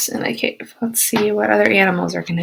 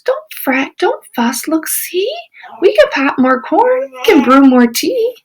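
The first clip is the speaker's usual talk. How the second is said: high little mouse voice; squeaky mouse; 6 semitones higher than usual. Acted. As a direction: squeak it reassuringly. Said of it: "Mouse squeaks".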